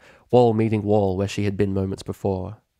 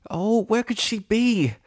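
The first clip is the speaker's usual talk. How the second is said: high, slow anxious inner voice; nervous wondering; 13 semitones higher, slower than usual. Acted. Bride waits for her friend, high slow anxious.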